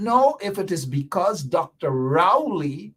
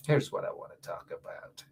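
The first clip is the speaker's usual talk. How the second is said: whispered, much softer; 3 semitones lower, 10 dB quieter than usual. Shown as a demonstration. Radio host teasing listeners before the topic, whispered. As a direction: lean in and whisper it.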